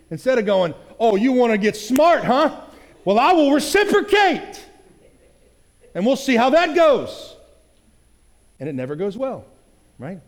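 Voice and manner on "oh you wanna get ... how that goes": high, loud, slow snarky comeback; combative retort. Retold or imitated. To a partner after a snide tone, a hammed-up snarky comeback. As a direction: pick a fight with a sneer.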